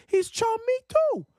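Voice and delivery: imitating a New York accent, high and laughing; mock the old New Yorker's accent through laughter; laughing New York accent impression. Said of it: "in his perfect New York accent".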